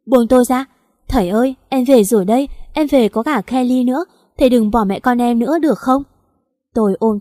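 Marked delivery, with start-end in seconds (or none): 0.1-0.7 louder desperate cry to be let go; a frantic, louder "let me go"; shout. Acted. Struggling against people holding her. 1.1-6.0 desperate pleading. Acted. Begging at dying husband's bed.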